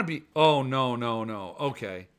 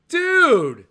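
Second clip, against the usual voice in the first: a loud, high burst of dude; excited; 10 semitones higher, 10 dB louder than usual. Real, not acted. Pitching the Nashville plan to Jeff, excited dude. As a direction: jumps in, much higher and louder.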